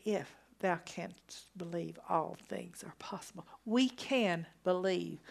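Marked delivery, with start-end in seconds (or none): 0.1-3.5 hushed, much softer retelling of the verse; soft repeat. Acted. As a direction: let it drop to a near hush. Same verse repeated softly.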